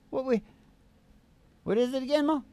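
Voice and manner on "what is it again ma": ordinary talk, nothing marked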